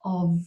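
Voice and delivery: of whispered, ending in V. Shown as a demonstration. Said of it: "a V sound".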